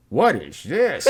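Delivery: lightly voiced puzzled gangster; a hint of a confused mobster. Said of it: "the gangster's like".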